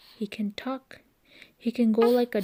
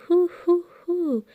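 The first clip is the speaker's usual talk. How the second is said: high, loud hooting; owl hoots; 9 semitones higher, 5 dB louder than usual. A narrator's reading. Reading to a child, hooting like an owl. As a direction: hoot like an owl. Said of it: "He can go like an owl".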